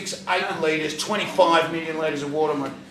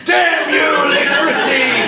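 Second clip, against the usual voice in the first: much louder, higher shouting curse; mock furious shout; 11 semitones higher, 10 dB louder than usual. Cursing the nurse's written order, mock furious shout. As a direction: shake your fist at literacy. Comic outburst at written rule.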